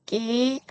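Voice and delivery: tense the onset of 끼, no air. Shown as a demonstration. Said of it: "이렇게 읽으시고요". (된소리로 높게 끼)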